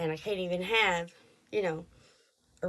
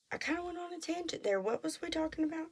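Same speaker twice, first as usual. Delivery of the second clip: quick quiet aside; 8 semitones higher, 8 dB quieter, faster than usual. Real, not acted. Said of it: "aside about tangent".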